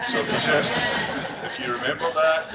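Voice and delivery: high, loud words broken by real laughter; laughing. Real, not acted. Speaker laughing at his own Scotland joke to the audience, laughing. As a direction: laugh through the punchline.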